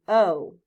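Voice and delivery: long vowel O; long O sound. Shown as a demonstration. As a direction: stretch the O for the class. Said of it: "long O sound".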